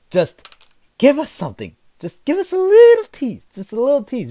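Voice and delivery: high, loud mock whine; whiny begging. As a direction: beg like a squeaky child. Begging Capcom for a teaser.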